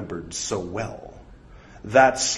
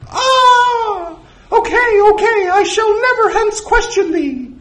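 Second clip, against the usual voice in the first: shrieking falsetto yelp into pleading; loud high-pitched cartoon panic; pained, panicked submission; 10 dB louder than usual. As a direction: squeal as if shocked, then give in fast. Electrocuted, then submits in falsetto.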